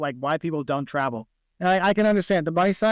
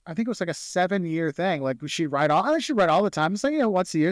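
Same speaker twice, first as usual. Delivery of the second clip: normal talking voice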